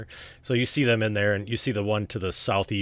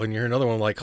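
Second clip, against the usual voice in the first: ordinary talk, nothing marked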